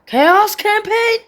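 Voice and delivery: high, drawn-out excited read; thrilled at the news. Real, not acted. Reading new feature excited.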